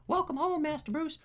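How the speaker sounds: very high, squeaky butler voice; Alfred. Acted. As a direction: try a silly high-pitched butler. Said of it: "we need a voice for Alfred".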